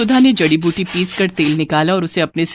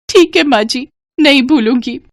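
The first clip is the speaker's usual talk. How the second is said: higher-pitched, obedient bride; meek daughter-in-law; 6 semitones higher than usual. Acted. Bride answers her mother-in-law, meek and obedient. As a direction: answer dutifully in a high voice.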